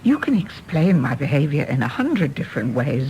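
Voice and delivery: usual speaking voice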